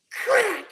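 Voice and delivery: sharp high loud crack sound; imitated gunshot crack.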